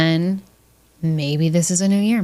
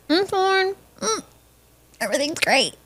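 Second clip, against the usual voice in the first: high, tight strain; strained, high-pitched fake okay; constipated, gritted teeth; 12 semitones higher, 4 dB quieter than usual. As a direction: force it out through clenched teeth. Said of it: "I sound constipated when I do that".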